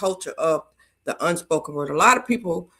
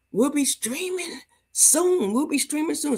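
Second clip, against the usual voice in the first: high, sing-song announcement; pitched way up, playful sing-song; sing-song tease; 10 semitones higher than usual. Real, not acted. Teasing announcement of coming streams.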